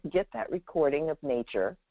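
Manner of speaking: ordinary talk, nothing marked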